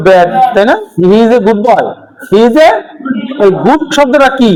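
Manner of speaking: ordinary delivery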